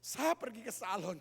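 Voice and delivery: acted tearful protest, rising to a wail; crying wife. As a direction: sob between phrases as the hurt wife. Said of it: "istrinya langsung menangis".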